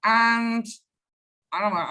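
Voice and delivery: ordinary talk, nothing marked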